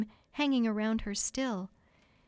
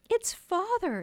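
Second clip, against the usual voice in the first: high-pitched, excited discovery; girl's surprised cry; amazed exclamation; 10 semitones higher, 3 dB louder than usual. A narrator's reading. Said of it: "exclaimed Wendy".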